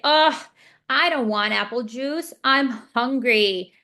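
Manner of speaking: put-on demonstrating the phrase the child should say; modeling with intonation. Shown as a demonstration. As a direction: say it slowly and brightly so the child can copy. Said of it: "What do I model in that scenario?".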